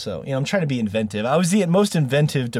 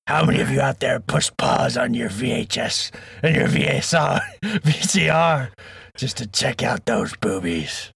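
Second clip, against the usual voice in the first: lightly voiced pirate, slow and drawn out; a hint of a hammy pirate growl. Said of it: "I was matching you with the pirate voice".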